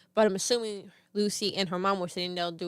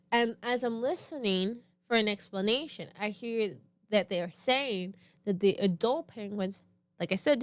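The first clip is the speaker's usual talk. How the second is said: ordinary talk, nothing marked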